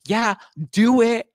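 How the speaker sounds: very high, loud, drawn-out demand; hammed-up indignant squeal; going hard on her. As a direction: imitate a bratty customer's order. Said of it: "he was just going so hard on her".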